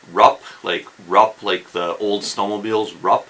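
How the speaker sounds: Rup said short with a U vowel like a snowmobile; U sound in Rup. Shown as a demonstration. Teaching viewers the Rup sound, short and clipped. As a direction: demo the first syllable Rup, like an old snowmobile. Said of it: "it's more of a U sound".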